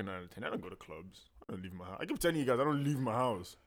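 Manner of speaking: usual speaking voice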